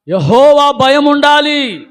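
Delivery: slow, weighty stress on Jehovah; slow emphatic. Shown as a demonstration. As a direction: lean hard on Jehovah, drawn out. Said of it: "ఏముండాలి?".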